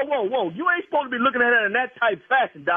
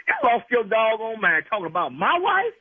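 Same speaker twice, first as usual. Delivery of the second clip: ordinary talk, nothing marked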